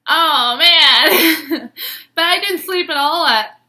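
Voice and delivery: loud, laughing through the words; giddy laugh as you talk; high, loud giddy laughter. Real, not acted. Laughing at his love text.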